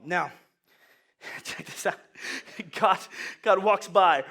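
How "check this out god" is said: ordinary delivery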